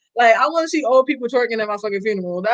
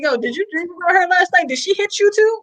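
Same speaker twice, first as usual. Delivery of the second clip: mock excited friend; 7 semitones higher than usual. Friend imitated recounting slap dream.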